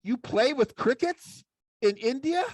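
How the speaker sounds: high, puzzled puppet voice; puppet sidekick. Acted. Puppet sidekick puzzled about crickets in India, high. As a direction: ask it high and baffled.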